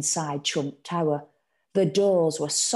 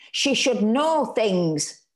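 slow, stubborn, lightly raised; insisting; 5 semitones higher, 4 dB louder, much slower than usual. A narrator's reading. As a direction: dig in your heels. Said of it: "Ma insisted".

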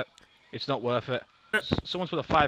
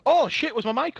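startled; 7 semitones higher than usual. Real, not acted. Realises mic was on.

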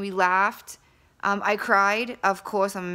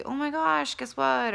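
higher, bursting to share; excited news; 6 semitones higher than usual. Pretend. Imagining calling a friend with big news, excited. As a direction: pitch it up like you can't hold the news in.

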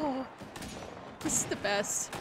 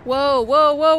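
ordinary talk

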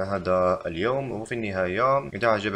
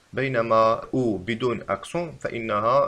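normal talking voice

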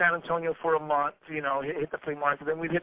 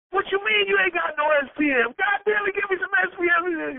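much higher, louder mock rant; raising hell; 13 semitones higher, 4 dB louder than usual. Friend raising hell in stores demanding SPM records. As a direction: yell it like demanding at the counter. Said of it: "raising hell".

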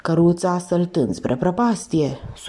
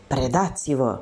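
ordinary delivery, nothing marked

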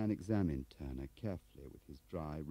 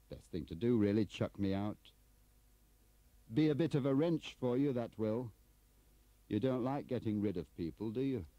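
raised, louder taunting tone; goading; 6 semitones higher, 6 dB louder than usual. Acted. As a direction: needle the boss into firing you. Turner goads Bradfield into dismissing him.

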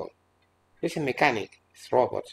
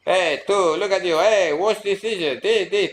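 higher, louder nagging call; mock trivial chatter; 8 semitones higher, 4 dB louder than usual. Mimicking a spouse's trivial chatter at home, hammed-up. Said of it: "to speak like this triviality".

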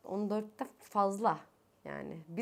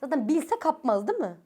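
louder, higher and fed up; an irritated rant, a little louder; irritated outburst; 6 semitones higher, 8 dB louder than usual. Real, not acted. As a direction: vent the annoyance. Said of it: "Sinir oldum".

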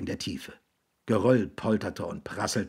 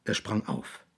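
ordinary delivery, nothing marked

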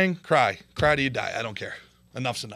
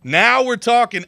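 louder, slower and higher, relishing it; emphatic repeat; 7 semitones higher, 9 dB louder than usual. Real, not acted. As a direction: savor it the second time with gusto. Repeats phrase louder second time.

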